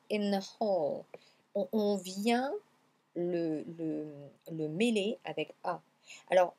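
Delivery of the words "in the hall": "in the hall" joined as "in-na hall"; liaison IN-THE. Shown as a demonstration. Teacher explains the liaison, "in the hall" said linked. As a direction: blend the TH of "the" into the N of "in". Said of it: "on fait la liaison".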